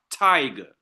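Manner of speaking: "tiger" weighted on its first syllable; stress on first syllable. Shown as a demonstration. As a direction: lean on the "ti" in "tiger". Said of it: "where the first syllable is stressed".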